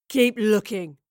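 loud, firm order; commanding. Acted. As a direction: snap the command. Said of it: "commanded the man".

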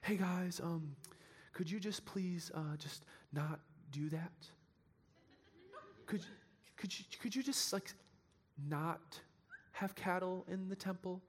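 timid Jesus politely asking the merchants to stop, softly; passive, timid Jesus. Pretend. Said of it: "not some passive Gandhi figure".

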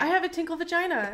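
excited. Acted. Gleeful sing-song announcement.

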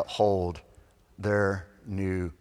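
usual speaking voice

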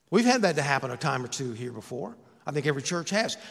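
usual speaking voice

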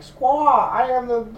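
very high, screechy bird squawk voice; high mock bird voice ranting; squawking bird person. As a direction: squawk it like a deranged parrot. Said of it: "people that are supposed to be birds".

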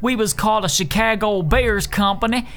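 Pete. Acted. Company CEO selling moving services, folksy ad voice. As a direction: pitch your business like a homespun local owner.

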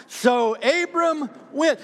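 high, slow, loud with a hit on went; slow loud climax on went; emphatic reading. Shown as a demonstration. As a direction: land hard on went. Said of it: "one more time".

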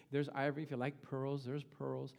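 usual speaking voice

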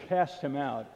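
ordinary talk

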